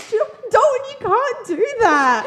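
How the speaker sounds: high, loud, drawn-out mock protest; laughing scolding. Sister scolds brother for selling her number, laughing protest. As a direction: scold him loudly through laughter.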